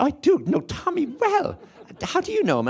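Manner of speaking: very high, excited Tipperary maid; imitated Clonmel woman, pitched right up; Clonmel accent. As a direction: squeal recognition in a Clonmel accent. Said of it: "their maid was from Clonmel".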